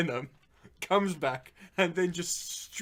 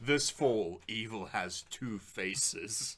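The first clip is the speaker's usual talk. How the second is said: low, slow trailer announcer; deep ominous tagline read; horror trailer voice; 7 semitones lower, 5 dB quieter, slower than usual. Pretend. Parody movie trailer tagline.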